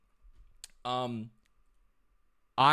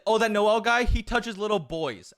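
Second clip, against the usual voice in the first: raised, higher voice, calling it out; loud retold accusation; 6 semitones higher, 4 dB louder than usual. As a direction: announce it for everyone around to hear. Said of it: "I said it really loud".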